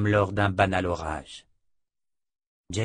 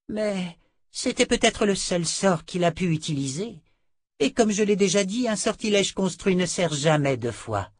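higher, insistent character voice; sorceress reasoning aloud; 10 semitones higher than usual. A narrator's reading. Sorceress explaining constructed spells, higher and insistent. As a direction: argue the point in a raised voice.

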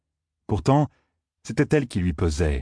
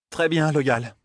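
higher, controlled cold voice; icy cutoff; 7 semitones higher, much slower than usual. A narrator's reading. Aes Sedai interrupts Loyal's rambling, icily. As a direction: cut off the rambler coolly. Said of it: "d'une voix glaciale".

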